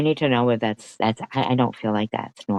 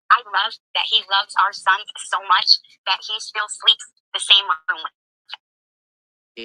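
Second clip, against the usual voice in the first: high, loud sing-song put-on wife voice; mocking imitation; 8 semitones higher, 4 dB louder than usual. Streamer mimicking the caller's proud wife, high and mocking. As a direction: ham up her smug words, pitched way up.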